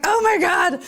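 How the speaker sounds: high, strained cry of pain; pained gasp. Retold or imitated. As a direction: wince out the words as the needle hurts. IV hurts on a speed bump.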